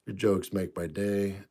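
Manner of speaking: ordinary talk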